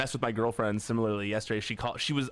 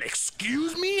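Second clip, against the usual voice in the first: very high indignant "excuse me"; mock high, offended girlfriend; much slower than usual. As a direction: bristle like an offended stranger. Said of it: "got all mad".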